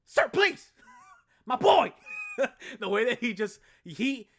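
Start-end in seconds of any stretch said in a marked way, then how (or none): 0.1-1.9 high, loud comic yell; imitated high-pitched hollering; hyped mock shout. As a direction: shout it out like a hyped video host. Imitating Dashie's hype.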